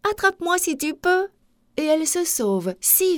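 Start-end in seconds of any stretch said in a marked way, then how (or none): 0.1-1.3 high-pitched playful challenge; high storybook taunt; taunting little galette. A narrator's reading. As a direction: dare them in a high little voice.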